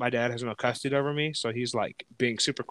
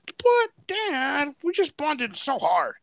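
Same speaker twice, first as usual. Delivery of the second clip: high, slow, drawn-out mock plea; pleading whine; 11 semitones higher, much slower than usual. Child begging dad not to tell mom, pleading whine. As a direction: whine it like a let-down kid.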